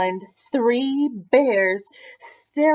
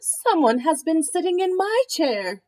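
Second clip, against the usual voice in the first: a higher, lightly voiced Mama Bear; Mama Bear voice, a bit higher than narration; middle-sized voice; 6 semitones higher, 3 dB louder than usual. A narrator's reading. Mama Bear notices her chair, higher middle voice. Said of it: "said in her middle-sized voice".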